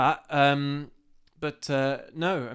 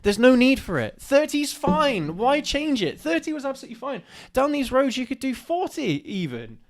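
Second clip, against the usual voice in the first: exasperated rant; 10 semitones higher than usual. Real, not acted. Heated rant about speed limits.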